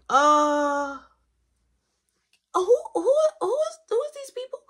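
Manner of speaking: high, loud, stammering voice, rushing at the end; shocked stammer. Retold or imitated. Kiki, seeing strangers pile into her car, stammers high and loud in shock. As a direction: sputter in disbelief.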